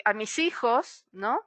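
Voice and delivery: ordinary talk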